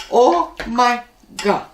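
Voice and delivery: drawn-out, high imitation of her accent; mother-in-law's Oh my God. Vlogger imitating his mother-in-law's exclamation, slow and high. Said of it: "She says".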